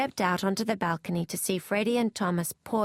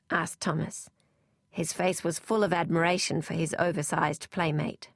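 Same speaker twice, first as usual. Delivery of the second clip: ordinary delivery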